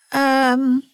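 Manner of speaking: put-on old professor wrong-footed by kindness; flustered old professor. A narrator's reading. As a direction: stall awkwardly, thrown off balance.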